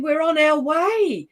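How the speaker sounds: high, drawn-out happy announcement; excited reply. Retold or imitated. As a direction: imitate family announcing it excitedly, slow and high. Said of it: "So great excitement".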